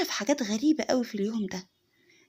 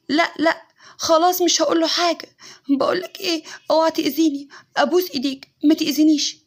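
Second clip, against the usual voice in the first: higher, louder, begging in panic; frightened pleading; 4 semitones higher, 7 dB louder than usual. Retold or imitated. Wife begging an unseen attacker not to hurt her, panicked. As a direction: plead for mercy, half laughing at first. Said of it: "وفضلت تقول".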